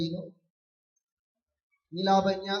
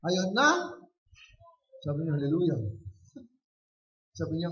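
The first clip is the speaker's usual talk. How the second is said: normal talking voice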